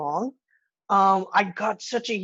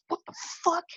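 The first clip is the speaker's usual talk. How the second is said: much higher, softer stunned gasp of a question; stunned disbelief; 8 semitones higher, 8 dB quieter, faster than usual. Retold or imitated. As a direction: caught completely off guard. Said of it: "everyone that watches the movie ... is just like".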